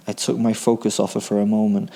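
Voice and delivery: ordinary delivery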